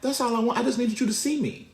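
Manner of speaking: lightly voiced toddler, pitched well up; toddler son. Toddler explaining he just needed to be seen, high pleading imitation. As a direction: plead it like a little kid, high. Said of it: "he's like".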